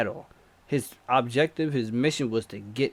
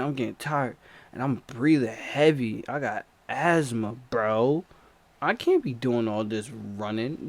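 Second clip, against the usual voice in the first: plain reading voice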